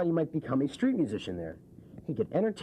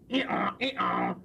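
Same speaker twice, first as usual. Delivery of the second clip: donkey bray; 7 semitones higher than usual. Pretend. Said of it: "Each time the donkey said".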